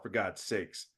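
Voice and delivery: normal talking voice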